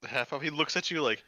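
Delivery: normal talking voice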